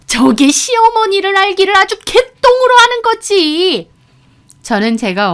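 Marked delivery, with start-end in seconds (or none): 0.1-3.9 high-pitched, loud furious rant; angry yelling. A narrator's reading. Mother-in-law pointing at daughter-in-law, angry yelling. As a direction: yell it out, pitched way up. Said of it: "삿대질을 하며 소리를 지르고".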